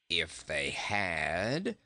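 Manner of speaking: low, very slow unruffled goat; calm reply. A narrator's reading. Goat answers the surprised boy, low slow calm. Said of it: "calmly replied Bilbil".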